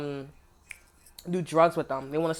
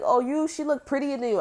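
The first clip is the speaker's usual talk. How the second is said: mock high taunt; 8 semitones higher than usual. Mocking someone's taunt.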